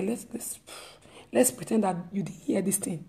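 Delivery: whispered, conspiratorial let's pretend; hushed whisper. Church member quietly hushes gossip about a sister, whispered. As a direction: whisper it like keeping a secret.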